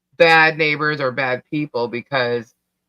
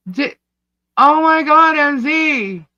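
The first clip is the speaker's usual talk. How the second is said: surprised delight; 9 semitones higher, 4 dB louder, slower than usual. Real, not acted. Surprise at a gift.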